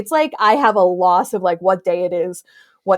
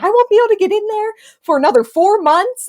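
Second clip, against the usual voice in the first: mock crying; 11 semitones higher, faster than usual. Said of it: "crying, and I was like".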